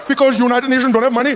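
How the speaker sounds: lightly voiced Indian father; a father scolding his kids in an Indian accent; Indian dad accent.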